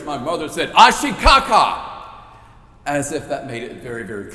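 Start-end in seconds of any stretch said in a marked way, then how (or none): 0.7-1.9 much higher, much louder exasperated cry; slow, loud, fed-up insistence; frustrated. Retold or imitated. Mother frustrated her husband doesn't understand, loud exasperated. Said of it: "getting very, very frustrated".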